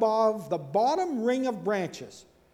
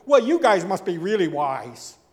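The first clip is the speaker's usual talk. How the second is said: louder, mocking praise; sarcastic; 8 dB louder than usual. Job mocks his three friends, loud sarcasm. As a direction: praise them so they hear the scorn. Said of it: "he says very sarcastically".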